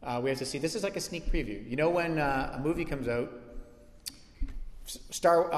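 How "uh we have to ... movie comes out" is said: ordinary talk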